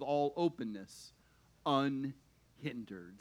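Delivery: low, measured Bible reading; scripture reading. Acted. Preacher reads the closing verses to his congregation, measured scripture reading. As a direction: read it out solemnly, slowing and softening toward the end.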